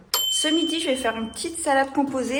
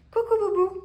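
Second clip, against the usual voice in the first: high pet-talk greeting; 9 semitones higher than usual. Real, not acted. Greeting her pet Boubou, high baby-talk hello. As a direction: coo a little hello.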